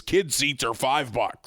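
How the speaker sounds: ordinary delivery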